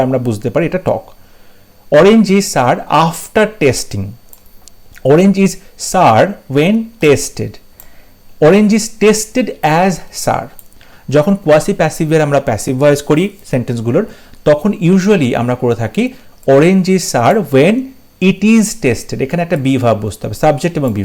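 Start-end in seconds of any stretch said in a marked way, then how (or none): 2.0-10.5 each word slow and separate; slow, careful reading of an exam option; slow dictation. Shown as a demonstration. Reading answer options to students. 16.5-19.2 each word slow and separate; slow, careful reading of an exam option; slow dictation. Shown as a demonstration. Model answer read to students.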